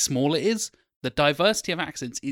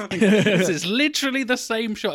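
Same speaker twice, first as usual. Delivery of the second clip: laughing; 7 semitones higher than usual. Real, not acted. Podcaster laughing at two identical film openings. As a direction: laugh out the complaint.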